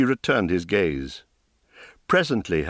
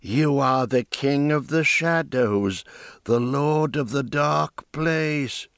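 slow, higher, awed voice; awed old woman; 4 semitones higher, slower than usual. Acted. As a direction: marvel at him slowly.

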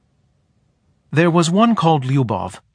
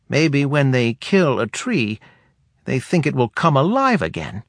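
put-on higher, dry old woman; wry, higher elder woman voice; head woman voice; 5 semitones higher than usual. A narrator's reading.

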